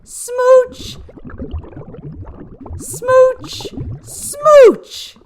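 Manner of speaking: very high, loud, drawn-out smooches; smoochy kisses. Acted. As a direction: blow each smooch like a big kiss. Fish blowing kisses joyfully.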